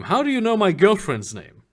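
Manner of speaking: loud, sharp suspicious question; startled and wary; suspicious surprise. Acted. NPC caught off guard, suspicious.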